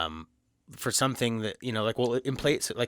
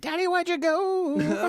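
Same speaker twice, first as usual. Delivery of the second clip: put-on high, slow little kid calling; child voice; much slower than usual. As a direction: plead like a lost child. Voicing abandoned child.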